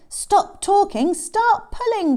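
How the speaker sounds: high, loud, urgent command; rally the helpers to action; calling out. Acted. Tick Bird to the animals helping, urgent call. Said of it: "called Tick Bird".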